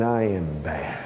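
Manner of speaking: very low, soft tough-guy voice; Clint Eastwood. Acted. New inmate psychs himself up, low tough-guy act. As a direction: drop way down, slow menace. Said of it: "put on my Clint Eastwood, John Wayne".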